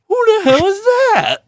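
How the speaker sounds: high squeaky mock Glenn Fricker. Streamer imitates Glenn Fricker reacting to a wrong name, squeaky high. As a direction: shriek it like a baffled Glenn Fricker. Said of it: "I was like Glenn Fricker".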